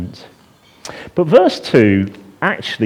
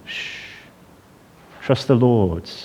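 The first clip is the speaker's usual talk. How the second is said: soft hushing; 7 dB quieter, slower than usual. Pretend. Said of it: "say to my heart".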